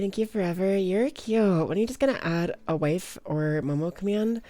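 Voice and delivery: ordinary talk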